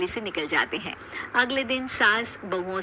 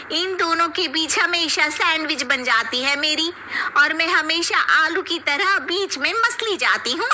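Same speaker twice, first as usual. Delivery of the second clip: put-on high, louder complaining young woman; whiny middle bahu; 8 semitones higher, 4 dB louder than usual. Middle daughter-in-law complaining about her sisters-in-law, high and whiny. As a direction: grumble about being squashed between them.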